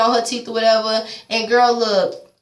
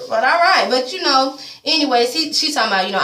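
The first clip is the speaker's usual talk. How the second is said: ordinary talk, nothing marked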